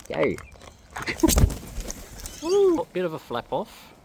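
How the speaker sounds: ordinary talk, nothing marked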